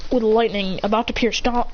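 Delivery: ordinary talk, nothing marked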